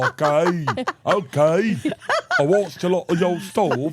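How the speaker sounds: high, loud, laughing British impression; bad British accent. Shown as a demonstration. Demoing the British comic, bad British accent with laughs. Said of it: "I'm trying to do it right now".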